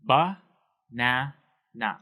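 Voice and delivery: slow "Ba-na-na", each syllable separated. Shown as a demonstration. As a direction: break "Ba-na-na" into three with clear gaps. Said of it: "we have those three syllables".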